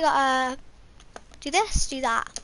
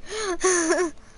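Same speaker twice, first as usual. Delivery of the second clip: burst of laughter; 5 semitones higher than usual. Real, not acted. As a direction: crack up at yourself. Laughs after own chant.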